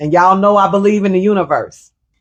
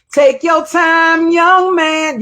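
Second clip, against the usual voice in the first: put-on much higher and slow, an old mother's voice; imitating mama; 9 semitones higher, slower than usual. Quoting mama's advice to her son, high and slow. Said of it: "mama used to say".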